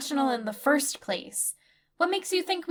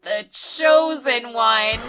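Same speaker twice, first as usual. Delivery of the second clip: grand title; 5 semitones lower, 4 dB louder, much slower than usual. Acted.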